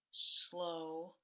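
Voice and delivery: drawn out, low and fading; slow, lowering and trailing off quietly. Shown as a demonstration. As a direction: stretch it out and let it sink. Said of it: "is slow down".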